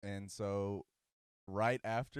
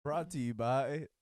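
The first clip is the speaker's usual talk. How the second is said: loud slow announcer; hammed-up commercial voice; mock ad read; 7 semitones higher, 9 dB louder, slower than usual. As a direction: boom it like a sponsor spot.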